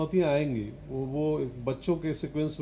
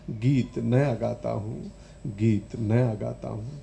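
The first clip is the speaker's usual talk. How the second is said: lower, subdued recitation; measured, low-pitched verse line; poem refrain; 5 semitones lower, slower than usual. Acted.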